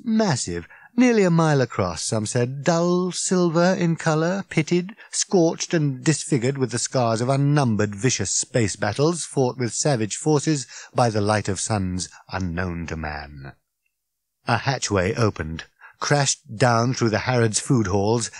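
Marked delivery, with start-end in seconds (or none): none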